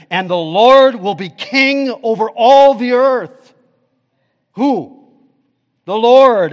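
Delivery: loud, high proclamation; preaching climax. Acted. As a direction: cry it out over the crowd.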